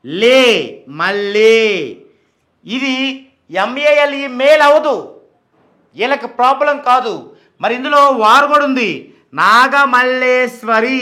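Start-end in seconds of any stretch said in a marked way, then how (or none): 0.0-1.6 లే and మల్లే with a long 'lee'; syllable demo. Shown as a demonstration. Teacher isolates syllables of name. 9.4-11.0 నాగమల్లేశ్వరి slowly drawn out; pronunciation demo. Shown as a demonstration. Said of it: "ఇలా వచ్చింది".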